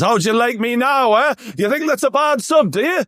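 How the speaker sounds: high-pitched taunting manager; Ange Postecoglou. Acted. Host mocks manager goading his fans, high taunting shout. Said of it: "Big Ange Postecoglou putting his ear out to the fans".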